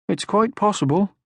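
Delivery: high, gentle motherly voice; kindly mother troll; Moomin Mama. Acted. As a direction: soothe him like a calm mother.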